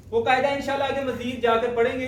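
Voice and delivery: normal talking voice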